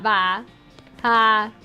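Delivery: usual speaking voice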